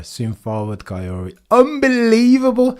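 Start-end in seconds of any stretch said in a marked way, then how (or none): 1.5-2.8 higher, punchy unbelievable; excited disbelief. Real, not acted. Streamer to chat about the pole, excited disbelief. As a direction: throw it out in shock.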